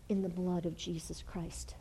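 a soft reverent whisper. Acted. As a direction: hush it reverently. Preacher drops to a whisper.

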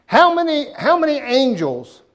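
high, loud rhetorical cry; booming question. Real, not acted. Preacher challenging the congregation, loud and high. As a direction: hit them with the question at full volume.

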